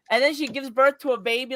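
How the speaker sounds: ordinary talk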